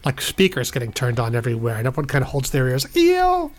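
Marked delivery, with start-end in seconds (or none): 3.0-3.5 disgusted ew. Acted. Crowd reacts to speaker noise.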